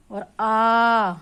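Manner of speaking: आ stretched very long and loud, mouth open. Shown as a demonstration. Teacher contrasting short अ with a long, open आ. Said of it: "इसमें हमारा मुंह खुलेगा बाहर की तरफ".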